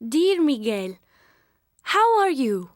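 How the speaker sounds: ordinary talk, nothing marked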